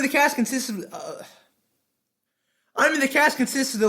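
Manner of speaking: high, loud, punchy review voice; animated anime-review narration; energetic script read. Acted. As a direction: hype up every list point.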